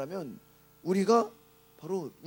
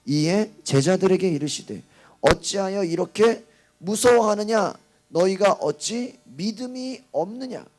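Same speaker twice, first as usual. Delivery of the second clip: slow, loud, emphatic recitation; scripture reading; 9 dB louder, slower than usual. Real, not acted. Preacher leading the congregation through verse forty, solemn loud reading. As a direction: read the verse out forcefully, drawing it out. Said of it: "사십 절 한번 읽어보겠습니다".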